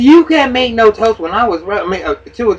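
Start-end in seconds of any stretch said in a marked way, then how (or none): 0.0-1.2 slow, loud, higher mock protest; imitating her cutting in slowly and loudly; retold mother loudly blocking the toast; mock loud objection. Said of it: "She said".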